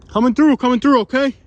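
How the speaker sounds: loud high call ahead; coming through shout. Real, not acted. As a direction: shout a warning into the woods. Announcing himself to hidden campers.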